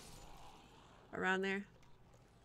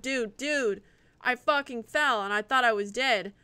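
usual speaking voice

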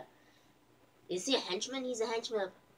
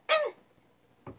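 high squeal; 5 dB louder than usual. Retold or imitated. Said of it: "he's like".

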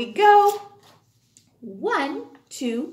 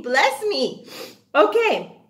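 ordinary talk

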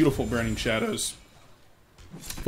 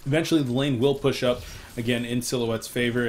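ordinary talk

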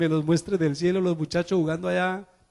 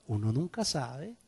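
ordinary talk, nothing marked

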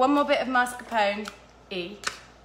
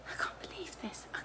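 whisper; 4 semitones higher, 17 dB quieter than usual. Real, not acted. Despairing at ruined cream.

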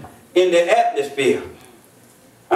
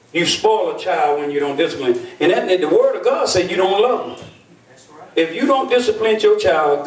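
usual speaking voice

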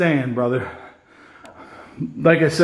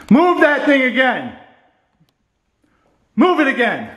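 challenging call; 8 semitones higher, 3 dB louder than usual. Real, not acted. Challenging the ghost.

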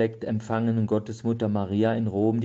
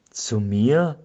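mild narrated cry; 5 dB louder than usual. A narrator's reading. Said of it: "schrie er".

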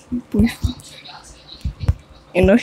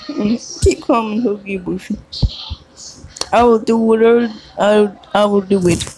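usual speaking voice

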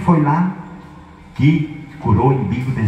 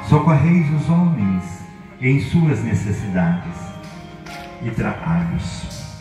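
prayer; 2 semitones higher, 4 dB quieter than usual. Acted. Leads a formal blessing prayer.

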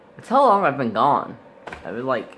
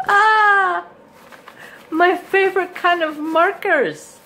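much higher, loud delighted cry; excited squeal; 5 dB louder, slower than usual. Real, not acted. Unwrapping the Secret Santa gift, thrilled squeal. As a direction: gasp with joy at the gift. Unwraps her favorite markers.

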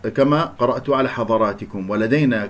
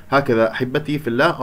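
ordinary talk

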